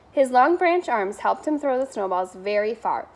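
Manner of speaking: ordinary delivery, nothing marked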